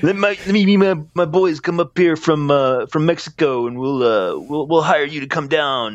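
high, hammed-up Mexican accent drifting toward surfer; mock Mexican accent slipping surfer. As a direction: let a fake Mexican accent slide into surfer talk. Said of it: "starting to sound like a surfer".

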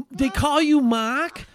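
loud, high, drawn-out incredulous question; retelling his own stunned reply; baffled disbelief. Retold or imitated. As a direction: ask it loudly, as if you can't believe it.

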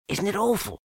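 high, small frightened voice; anxious piglet. A narrator's reading. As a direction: squeak it as a scared little creature.

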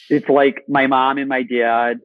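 hammed-up Michigan accent. Shown as a demonstration. Actor demonstrating his home state's terrible accent, Michigan accent. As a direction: channel a strong Michigan accent. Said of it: "I'll channel some of it".

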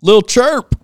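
loud, high hype shout; excited. Real, not acted. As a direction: call the name out like an emcee. Announcing the reviewer's name.

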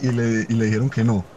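ordinary talk

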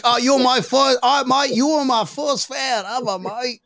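high-pitched, put-on Australian; mock Australian accent. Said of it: "better than the Australian accent".

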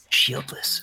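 unvoiced hushed whisper of the word. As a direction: breathe it like a rumour passed around. Whispered word floats to ears.

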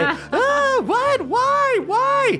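very high squealed protest; high-pitched, hammed-up cry of bewilderment; panicked scoutmaster. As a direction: squeal in alarm as if just tackled. Tackled scoutmaster cries out.

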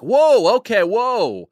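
high, loud startled whoa; a carriage full of people reacting in shock; loud surprised whoa from the crowd; crowd gasp. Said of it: "a bunch of people are just like".

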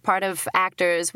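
ordinary talk

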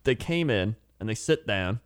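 normal talking voice

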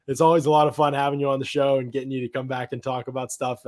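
ordinary talk, nothing marked

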